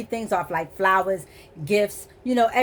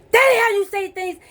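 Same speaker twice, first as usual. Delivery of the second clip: higher, louder mocking of his scolding; mock correcting; 10 semitones higher, 5 dB louder than usual. Woman imitating the man correcting her speech, louder and higher. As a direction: mimic him snapping a correction. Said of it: "he would say".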